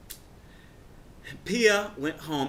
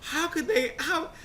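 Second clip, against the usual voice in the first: high, half-crying laugh; tearful giggly disbelief; giddy laughing; 14 semitones higher than usual. Retold or imitated. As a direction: squeak it out in shocked giggles. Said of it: "I was very giddy".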